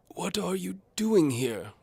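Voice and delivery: put-on high, slow, loud blustering old duke; pompous duke blustering at an intruder. A narrator's reading. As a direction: bluster like an outraged old lord. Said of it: "blusters the Duke".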